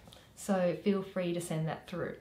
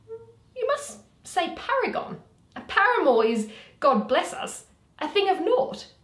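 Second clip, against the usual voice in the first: high, loud correcting Flute; 10 semitones higher, 8 dB louder than usual. Acted. Flute correcting Quince's word, high and loud. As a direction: correct him in a high, fussy pitch.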